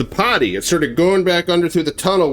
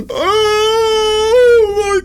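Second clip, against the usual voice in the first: high, loud, drawn-out terrified cry; terrified man crying out in the dark; panicked screech; 5 dB louder, slower than usual. Acted. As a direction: stretch it out in horror. Dirk feels bleeding.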